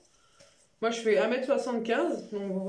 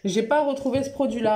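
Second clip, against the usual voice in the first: ordinary talk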